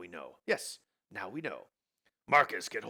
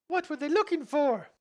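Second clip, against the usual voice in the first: Elsa voice; 10 dB louder than usual. Acted.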